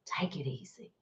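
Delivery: faint soothing whisper; fainter whisper. Shown as a demonstration. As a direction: let it fade to a hush.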